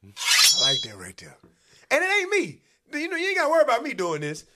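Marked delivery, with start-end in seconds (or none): none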